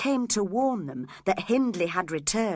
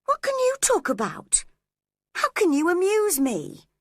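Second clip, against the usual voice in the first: sharp, much higher, cross challenge; 11 semitones higher, 4 dB louder than usual. A narrator's reading. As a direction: snap back at him, irritated and mocking. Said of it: "she asked crossly".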